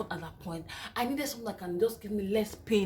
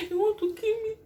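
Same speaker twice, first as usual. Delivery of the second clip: laugh-crying; 12 semitones higher than usual. Retold or imitated. Retelling labor pain, laughing-crying.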